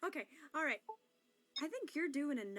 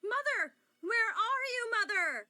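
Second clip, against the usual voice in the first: desperate call; 7 semitones higher, 7 dB louder than usual. Acted. Persephone calling her mother.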